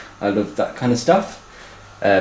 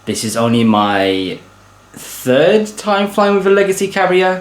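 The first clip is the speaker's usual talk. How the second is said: ordinary talk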